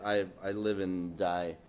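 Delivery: usual speaking voice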